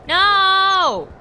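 long, high, loud wail of no; dismayed cry. Real, not acted. Player missing the pounce, dismayed shout. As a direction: cry out as it slips away. Bird gets away.

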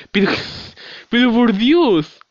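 loud, high, slow exasperation; exasperated cry. Real, not acted. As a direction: drag it out in disbelief. Exasperated at the game. (exasperado, alto y lento)

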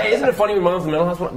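high, fast, laughing sitcom-comic delivery; Seinfeld impression. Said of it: "but I was doing it like Seinfeld".

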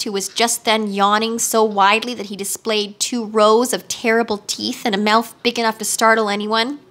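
plain reading voice

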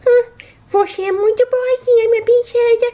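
high baby-voiced repeat; high pet echo. Shown as a demonstration. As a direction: echo mommy's words in a high sweet pet voice. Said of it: "pet echoes mom".

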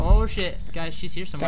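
alarmed. Real, not acted. Ghost event, alarm.

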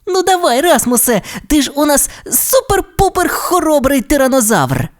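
put-on high, excited childlike wheedling; coaxing little dinosaur. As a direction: egg your friend on, high-voiced. Timmy urges Rasmus on.

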